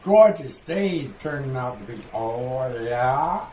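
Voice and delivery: loud, lifted imitation of a Maine local; hearty Maine small talk, hammed up; Maine accent. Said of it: "you can tell that one from Maine".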